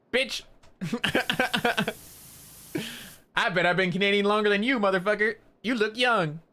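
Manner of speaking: high, hammed-up mock taunt; mock aggressive. Imagined taunt at the young Canadian guy, high mock outrage. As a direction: play at picking a fight, high and sassy. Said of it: "pretend I get aggressive".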